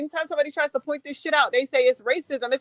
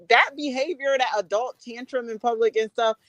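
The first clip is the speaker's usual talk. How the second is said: normal talking voice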